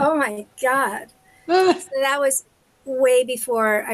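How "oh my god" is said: slow, awed Oh my God; starstruck, drawing the words out slowly; awed gasp. Retold or imitated. Retelling meeting her idol, slow and awed.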